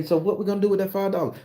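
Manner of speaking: high fast question. Acted. Questioner voiced in skit.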